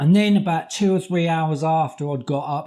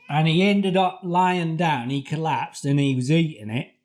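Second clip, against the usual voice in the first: ordinary talk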